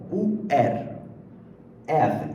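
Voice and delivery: R as a throaty French uvular; French guttural R demo. Shown as a demonstration. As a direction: scrape R at the back of the throat. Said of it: "A maioria fala R".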